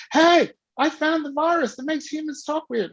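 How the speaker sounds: high, eager retold news; eager high-pitched hail; excited announcement. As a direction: announce a discovery to a friend. Retells announcing discovery to friend.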